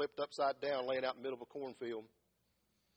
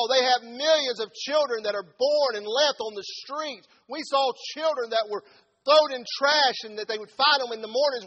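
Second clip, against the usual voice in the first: loud, high, fast preacher's cry; fiery preaching; 10 semitones higher, 14 dB louder than usual. Acted. Pastor preaching about neglected children, shouting fervently. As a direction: preach it out full force to the pews.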